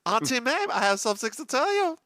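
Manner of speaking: high, put-on Southern-tinged French accent. Host has news for Auntie Mame, high French-Southern accent. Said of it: "with a Southern accent".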